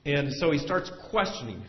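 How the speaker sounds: normal talking voice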